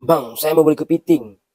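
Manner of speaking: customer asking. Acted.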